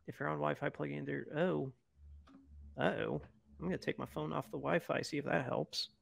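quiet, far from the mic; talking away from the phone; faint distant phone line. Real, not acted.

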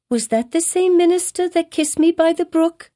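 put-on high, wondering little girl; child voice. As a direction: ask like an eager young child. Pearl asks her mother.

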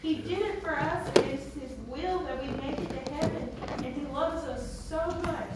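usual speaking voice

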